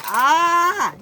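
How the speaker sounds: high, loud shriek of "ah". A narrator's reading. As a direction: shriek as you come off the rails. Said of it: "he shrieked".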